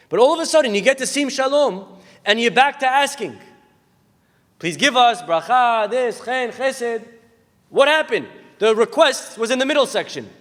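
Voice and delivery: high, loud, driving sermon voice; preaching at full force. Acted. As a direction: push the question home to the congregation.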